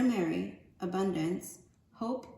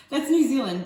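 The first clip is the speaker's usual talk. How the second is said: talking through laughter, loud; giggly correction; laughing; 6 semitones higher, 11 dB louder than usual. Real, not acted. Reader laughing as she corrects her own accent.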